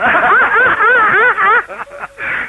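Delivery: high rising laugh; burst of laughter. Real, not acted. Laughing at the caller's remark, high rising laugh.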